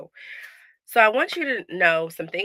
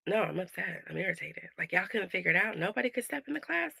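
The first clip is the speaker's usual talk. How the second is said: irritated; 8 dB quieter than usual. Retold or imitated. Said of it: "I'm like".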